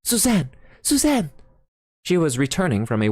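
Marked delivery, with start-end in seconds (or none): 0.0-1.3 high, urgent call across the house; anxious father calling his daughter. A narrator's reading. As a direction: call her name from another room. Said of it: "He called".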